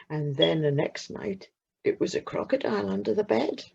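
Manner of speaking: ordinary delivery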